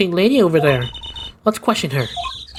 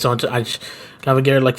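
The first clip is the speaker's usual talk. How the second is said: ordinary talk, nothing marked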